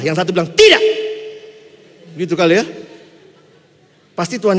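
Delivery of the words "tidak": sharp, high, loud no; angry shout. Retold or imitated. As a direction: shout the refusal down hard. Zealot disciple refusing, voiced.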